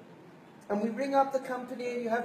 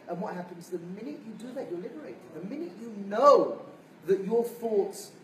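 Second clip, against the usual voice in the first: usual speaking voice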